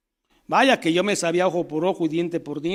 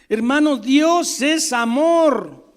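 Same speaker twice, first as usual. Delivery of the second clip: slow, high, loud, every word weighted; slow emphatic; 6 semitones higher, 4 dB louder, much slower than usual. Real, not acted. Preacher pressing the core truth on his brother, slow and heavy. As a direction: lean hard on Dios es amor.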